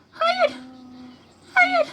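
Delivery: high, pleading no; a threatened figure begging no; high-voiced protest.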